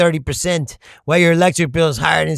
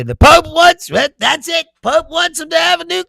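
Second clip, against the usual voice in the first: high, hammed-up Trump impression, a touch louder; mock Trump voice; 12 semitones higher, 6 dB louder than usual. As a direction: whine it like a mocked president. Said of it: "He's gonna say".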